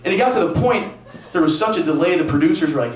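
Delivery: usual speaking voice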